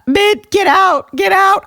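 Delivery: high, loud pretend exorcism; mock banishing shout. Host ordering the haunting co-host out, mock shout. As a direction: shout it high in play panic.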